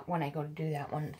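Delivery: normal talking voice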